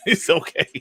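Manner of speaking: said through a real laugh; laughing reassurance. Real, not acted. Laughs while reassuring a viewer.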